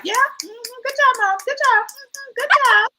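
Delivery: high, sing-song patting praise; patronizing daughter. Retold or imitated. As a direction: praise her like a pet, high and sweet. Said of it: "She was like".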